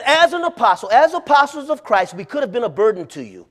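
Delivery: loud, high, proclaiming; thundering apostle voice; booming preacher. Acted. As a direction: preach it out like Paul, full force. Preacher booming Paul's words.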